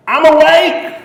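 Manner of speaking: high, loud wake-up yell; toddler's yell. Retold or imitated. Son yells from his bed every morning, yelling. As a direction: yell it like an excited two-year-old. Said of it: "he would yell this".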